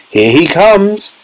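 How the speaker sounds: high, drawn-out call; excited yell. A narrator's reading. Wonka sees boy appearing on screen, excited mild yell. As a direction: announce it excitedly, stretching the words. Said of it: "yelled Mister Wonka".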